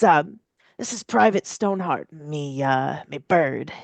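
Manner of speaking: a hint of an old pirate; lightly voiced salty sailor.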